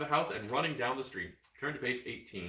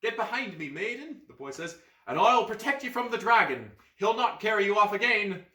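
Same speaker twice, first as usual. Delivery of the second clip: put-on heroic boy; 9 semitones higher, 7 dB louder than usual. Boy protecting the maiden.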